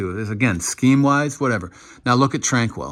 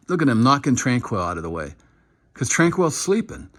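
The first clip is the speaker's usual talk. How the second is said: usual speaking voice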